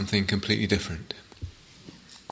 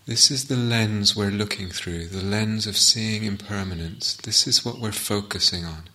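guided meditation. Acted. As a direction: ease them into the sitting, unhurried.

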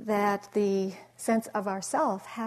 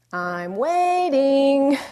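very slow, loud, high drawn-out warning; a kid's pointed, impatient ultimatum; 6 semitones higher, 11 dB louder, much slower than usual. Retold or imitated. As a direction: stretch it out, tapping your foot. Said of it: "And then he goes".

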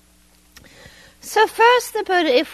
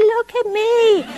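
mock child demand; 11 semitones higher, 4 dB louder, slower than usual. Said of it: "it was a good".

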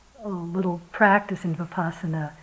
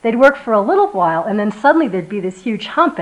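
ordinary talk

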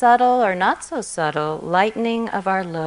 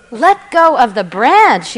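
higher, louder incredulous yell; distraught yell; 5 semitones higher, 10 dB louder than usual. A narrator's reading. Distraught man yells God's words back, incredulous. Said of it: "yelled the distraught man".

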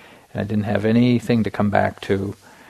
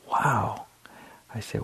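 usual speaking voice

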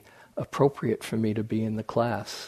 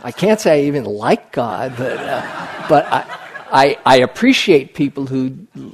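laughing; 10 dB louder than usual. Retold or imitated. Retold answer, laughing loudly.

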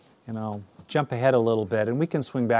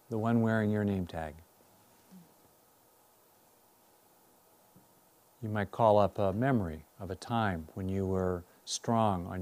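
guided meditation. Real, not acted. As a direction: guide them inward, unhurried and measured.

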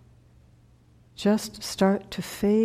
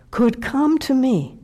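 ordinary delivery

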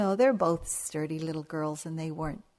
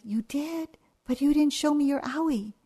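much higher, playful grandma surprise; mock surprise; 8 semitones higher, 4 dB louder than usual. Grandma coaxing the older girl, high and playfully surprised. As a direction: coax the child with wonder.

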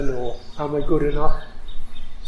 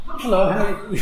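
mock polite greeting; 6 semitones higher than usual. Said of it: "so you just keep talking to it".

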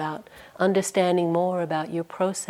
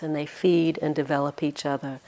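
ordinary talk, nothing marked

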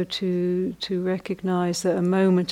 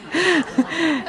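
giggle; 10 semitones higher, 4 dB louder than usual. Real, not acted. Laughing at forgotten joke.

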